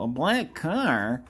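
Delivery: slow, drawn-out questioning game voice; game townsperson. Acted. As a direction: echo the question slowly, stretching it out. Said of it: "This guy would go".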